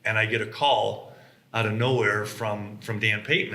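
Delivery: normal talking voice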